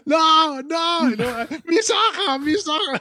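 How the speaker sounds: very high mock scream; Phoebe's hysterical shriek. Co-host acting out the favourite moment, mock hysterical shriek. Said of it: "Phoebe pegando con eso de".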